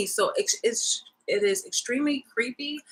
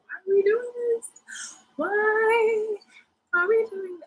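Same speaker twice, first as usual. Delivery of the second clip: high-pitched whine; 14 semitones higher than usual. Real, not acted. Cat owner with a hair in the eye, high whine.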